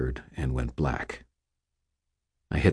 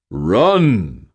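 resonant baritone; 5 semitones higher, 6 dB louder than usual. Acted. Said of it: "said a resonant baritone".

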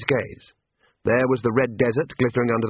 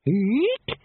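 very high short eek; sudden high animal squeal; ape's squeak; 11 semitones higher than usual. Acted. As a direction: squeak like a small ape. Librarian's squeak imitated.

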